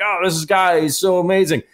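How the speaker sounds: put-on starry-eyed praise; mock gushing. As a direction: gush in mock awe. Mimicking fawning praise of player.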